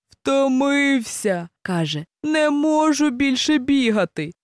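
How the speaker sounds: drawn-out, worn-out gasp of a word; out of breath after the races; exhausted hare. A narrator's reading. Hare collapses after the races, worn out.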